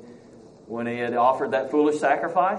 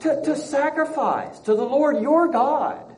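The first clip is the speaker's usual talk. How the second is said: high, slow, sing-song pious excuse; mocking Saul's excuse; 11 semitones higher, slower than usual. Teacher mocking Saul's pious excuse, high and slow. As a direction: play innocent, dragging out the excuse.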